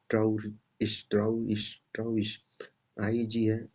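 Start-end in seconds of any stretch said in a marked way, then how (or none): 0.1-2.4 ट्राउरिश, the ig said as श; German ig ending. Shown as a demonstration. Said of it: "श की तरह पढ़ते हैं".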